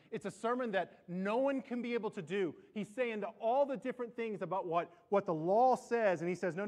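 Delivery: preaching at full force. Acted. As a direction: preach it hard to the crowd.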